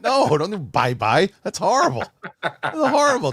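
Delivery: high, loud comic protest that breaks into a laugh; hammed-up appalled fan; mock horrified plea. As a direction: beg him to stop, laughing. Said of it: "And I'm like".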